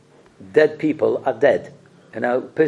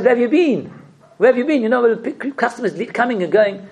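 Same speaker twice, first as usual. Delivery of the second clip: scolding wife; 8 semitones higher than usual. Retold or imitated. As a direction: play the wife scolding her late husband. Wife scolding husband in story.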